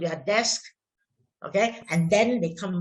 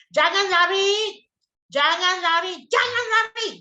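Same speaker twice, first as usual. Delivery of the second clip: scolding call; 9 semitones higher, 5 dB louder than usual. Acted. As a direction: call out to stop running children. Teacher acts famous school scolding.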